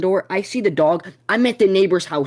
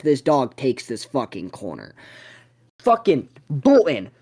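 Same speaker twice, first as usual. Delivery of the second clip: usual speaking voice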